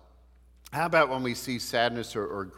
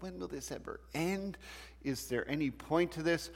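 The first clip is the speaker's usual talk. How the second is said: high, softer plaintive complaint; acted exasperation; 6 semitones higher, 4 dB quieter than usual. Someone tired of their own sadness, acted plaintive complaint. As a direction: imitate someone fed up, pitched up.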